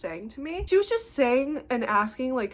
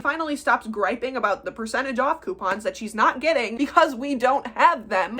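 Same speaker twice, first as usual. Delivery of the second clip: normal talking voice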